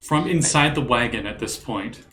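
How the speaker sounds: normal talking voice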